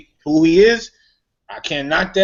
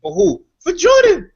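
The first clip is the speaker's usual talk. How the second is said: a much higher, louder burst of mock eagerness; mock eager; 4 dB louder than usual. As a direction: jump at the chance like a starstruck fan. Giving up his girl to Jordan.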